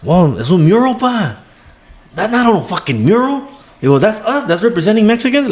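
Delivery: hammed-up outraged son, pitched high; indignant son. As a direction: squeal out the protest like a riled-up son. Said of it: "Le dice".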